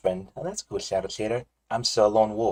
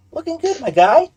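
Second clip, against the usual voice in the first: excited; 10 dB louder than usual. Real, not acted. Teasing friend seen on camera.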